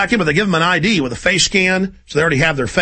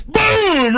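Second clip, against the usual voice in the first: mock gunshot shouted much higher; shouted boom. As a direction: yell boom like a pistol shot. Acted gunshot in execution scene.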